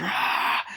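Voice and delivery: harsh voiceless mock scream; imitated scream. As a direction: let out a rasping acted yell. Imitating cry as bed is shaken.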